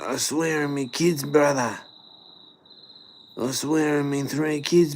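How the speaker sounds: high, pleading eshay voice; Ashay accent. Pretend. Eshay denying he took a wallet, high mock eshay accent. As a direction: protest innocence in a high Aussie eshay accent. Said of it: "speak in a bit of an Ashay accent".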